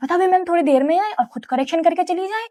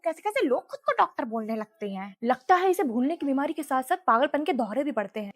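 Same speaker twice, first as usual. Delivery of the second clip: muttered aside; 3 semitones lower, 10 dB quieter than usual. Acted. Doctor mutters about forgetful patient.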